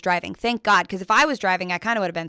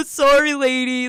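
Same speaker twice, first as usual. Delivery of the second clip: higher, louder imitated jeer with a hooting laugh; mocking cop impersonation; 4 semitones higher, 3 dB louder than usual. Cops at the scene jeering at a running woman, imitated mockery. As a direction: hoot with mock laughter, then taunt. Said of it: "the cops over here at the scene are like".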